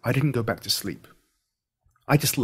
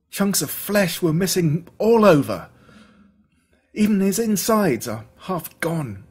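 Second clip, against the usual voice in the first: getting higher and louder, appalled; rising horror; 7 semitones higher, 7 dB louder than usual. Acted. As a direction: build from shock to horror. Friend describes mauled dog, rising.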